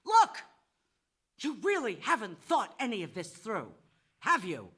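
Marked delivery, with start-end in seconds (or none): 0.1-0.4 loud, fed-up outburst; raised, exasperated voice; exasperated shout. Acted. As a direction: yell it, out of patience. Exasperated at kidnapper's bad planning.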